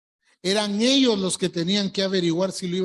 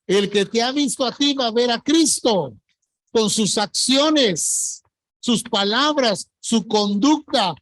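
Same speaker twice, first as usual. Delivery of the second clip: preaching at full force; 5 dB louder than usual. Acted. Preaching climax to congregation.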